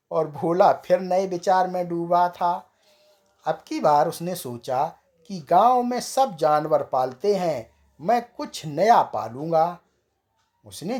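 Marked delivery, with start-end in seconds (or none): none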